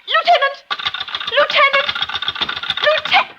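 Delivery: high, desperate repeated calls into the phone; calling out. Acted. Wife left alone calls to the lieutenant who hung up, frantic. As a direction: call after him, frantic. Line goes dead, she calls out.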